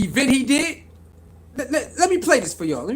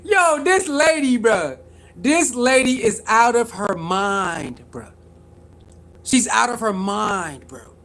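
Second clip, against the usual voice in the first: slow disbelief; 3 semitones lower, slower than usual. Real, not acted. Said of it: "slow incredulous complaint".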